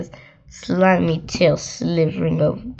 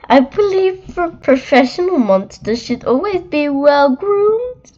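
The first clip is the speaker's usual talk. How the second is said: monster Ralph; 8 semitones higher than usual. Acted. Monster greeting a child in a high, prim voice. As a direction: play a well-groomed monster introducing himself. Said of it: "Ralph replied".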